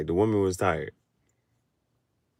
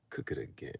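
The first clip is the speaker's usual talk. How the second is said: unvoiced whisper of the line; whispered meme voice; 17 dB quieter, faster than usual. Shown as a demonstration. To viewers, redoing the meme line, whispered. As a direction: breathe it like the meme caption. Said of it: "the voices".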